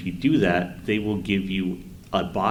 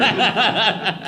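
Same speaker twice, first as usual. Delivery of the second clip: loud laugh; 12 semitones higher, 9 dB louder than usual. Real, not acted. Host laughing while recommending Berserk, loud laugh. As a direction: burst of high, loud laughter.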